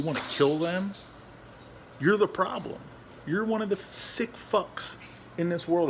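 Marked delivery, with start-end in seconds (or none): 2.0-5.9 loud, raised, accusing voice; angry rant. Real, not acted. Dog owner condemning animal abusers, real angry outburst. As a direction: lash out at the culprit, punching each accusation. Ranting at people who hurt dogs.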